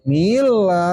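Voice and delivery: MILA with the first vowel stretched and swung up; exaggerated sway on "Mila"; swung syllable. Shown as a demonstration. Teacher showing what not to do, syllable swung long. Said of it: "Jangan suka ngayun-ngayun".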